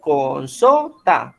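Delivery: slow "con sota", leaning on SO; stress on SO. Shown as a demonstration. Teacher confirming the students' answer, slow "con sota" stressed on SO. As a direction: draw out "con sota" with the weight on SO. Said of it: "En so".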